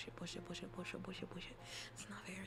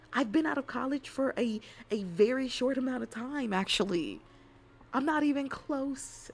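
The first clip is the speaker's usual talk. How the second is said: higher, louder mock outrage; loud indignant protest; 5 semitones higher, 10 dB louder than usual. Real, not acted. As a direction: protest your youth loudly. Insisting she is young.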